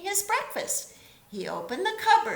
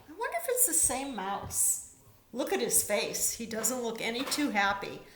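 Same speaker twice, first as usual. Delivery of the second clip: ordinary delivery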